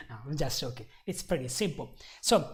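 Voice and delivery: normal talking voice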